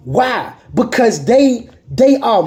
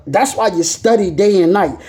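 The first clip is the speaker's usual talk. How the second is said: normal talking voice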